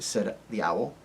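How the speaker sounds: ordinary delivery, nothing marked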